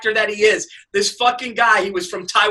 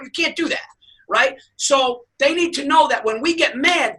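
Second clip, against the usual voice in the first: normal talking voice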